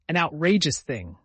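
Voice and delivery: loud, emphatic outrage; forceful indignant exclamation; violent indignation. A narrator's reading. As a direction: burst out, hitting "outrageous" hard. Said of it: "said Uncle Benjamin violently".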